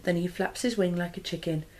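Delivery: ordinary delivery, nothing marked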